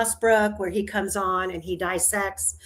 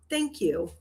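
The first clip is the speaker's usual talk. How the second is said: ordinary talk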